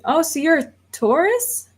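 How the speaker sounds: ordinary talk, nothing marked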